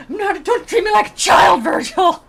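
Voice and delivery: high, very loud comic outburst; hammed-up yell at a patronising guide, laughing; indignant mock shout. Dante objects to being babied by Virgil, indignant mock shout.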